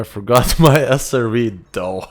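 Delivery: laughing through the words at a silly slip; laughing at himself. Real, not acted. Streamer caught forgetting his vehicle, laughing loud.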